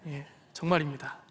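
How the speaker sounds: soft laugh then a chuckled reply. Real, not acted. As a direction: laugh it off as a joke. Joking denial to congregation. (웃으며 농담처럼)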